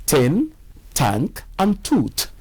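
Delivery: "tin, tank, and tooth" with hard t, no th; Nigerian t for th. Shown as a demonstration. Teacher shows learners the wrong t sound, firm and clear. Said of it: "instead of the correct".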